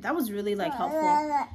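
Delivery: a real wobble of emotion on the word; tearing up. Real, not acted. Moved by the doctor's words.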